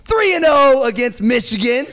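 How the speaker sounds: high, loud hype-man shout. Acted. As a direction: yell the stats to pump up the crowd. Hyping the guest's stats.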